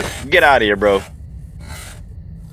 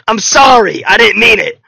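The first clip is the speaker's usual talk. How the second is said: loud, higher pleading sorry; loud anguished apology, slowing on oh my gosh; mock-anguished apology; 7 semitones higher, 6 dB louder than usual. Real, not acted. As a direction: wail a sorry to the game. Loud apology after ruining clip.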